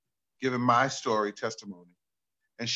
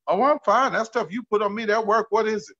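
mother's voice; 8 semitones higher, 5 dB louder than usual. Retold or imitated. Mother voiced in retold story.

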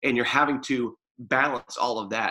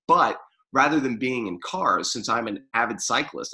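ordinary talk

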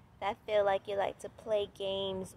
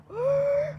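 high, loud theatrical gasp; mock gasp; 9 dB louder than usual. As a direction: gasp in exaggerated shock. Mock shock at recurring light pole.

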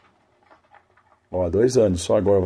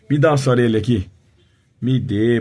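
louder, higher demand to the dog; bossing the puppy, loud; loud playful order; 4 semitones higher, 6 dB louder than usual. Real, not acted. Owner demanding the dog's ear, loud and playful.

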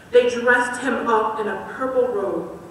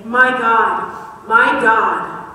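loud, aching cry to the Father; anguished call; 7 dB louder, much slower than usual. Acted. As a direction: call out to God in despair. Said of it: "called out once more".

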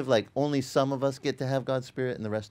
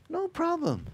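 higher, eager waiter voice; high mock waiter. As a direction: play the cheerful waiter. Waiter voiced in retold scene.

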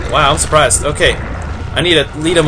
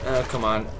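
much softer, low begging; hushed pleading; 9 semitones lower, 13 dB quieter, faster than usual. Real, not acted. Gamer begging monster not to sneak up, hushed. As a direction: plead under your breath.

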